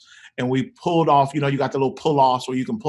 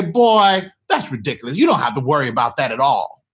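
emphatic dismissal; 7 dB louder than usual. Retold or imitated. Dad brushing off his son's outlandish worry, emphatic. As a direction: wave the worry away with force. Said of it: "emphatically look him in the face and say".

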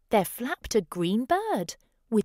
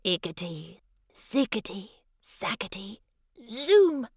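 muttered spell. A narrator's reading. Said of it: "she muttered a spell".

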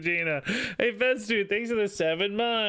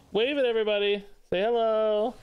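usual speaking voice

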